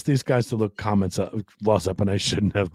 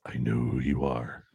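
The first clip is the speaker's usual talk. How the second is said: low, slow and menacing; creepy low voice; 8 semitones lower, 7 dB quieter, slower than usual. Shown as a demonstration. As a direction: say it like a lurking stalker. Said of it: "It's what you said".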